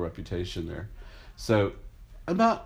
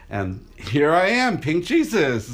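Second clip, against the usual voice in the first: slower, much higher and louder; proud showman's entrance; triumphant reveal; 7 semitones higher, 6 dB louder, slower than usual. Real, not acted. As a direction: announce yourself like a grand unveiling. Grand reveal at the parade.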